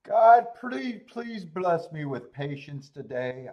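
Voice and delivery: loud, slow mock prayer; praying for patience through teeth. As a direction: beg the heavens, hammed up. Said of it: "God, give me the patience".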